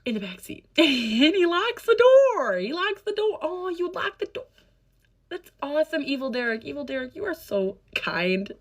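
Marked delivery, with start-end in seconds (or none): 0.8-3.4 laughing, high, slow disbelief; can't believe what she's saying; disbelieving. Real, not acted. Stunned retelling, laughing. 3.4-8.5 high, dripping mock-sweet sarcasm; sarcastic praise. Sarcastic address to Derek.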